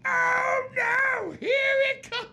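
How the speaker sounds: very high, loud mock squeal; excited squeal. [name] squealing to his friend as the band starts. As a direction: squeal like a thrilled fan. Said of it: "I just look at this guy".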